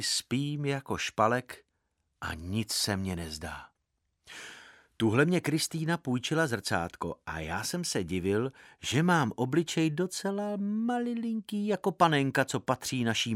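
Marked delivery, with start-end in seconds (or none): none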